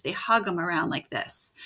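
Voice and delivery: normal talking voice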